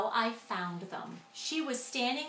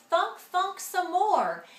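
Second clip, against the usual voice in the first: higher, louder, slow pleading call; will the thinker on with all your might; urgent cry; 7 semitones higher, 6 dB louder than usual. A narrator's reading. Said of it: "She cried".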